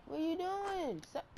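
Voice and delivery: can't believe what you're seeing; exasperated yell. Real, not acted. Yelling at a bad play.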